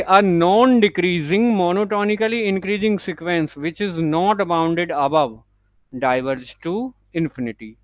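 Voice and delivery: very slow, word by word, for notes; slow dictation. Shown as a demonstration. Teacher dictating the English note to students, very slowly. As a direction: dictate it so students can write along.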